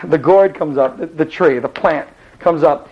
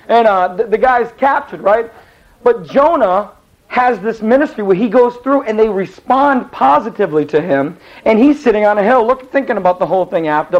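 ordinary delivery

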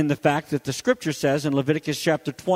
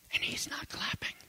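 breathless hushed whisper; a heartbroken secret whisper; 14 dB quieter than usual. Retold or imitated. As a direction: barely breathe the words. Girl confides about teacher.

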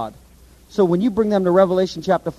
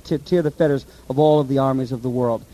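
usual speaking voice

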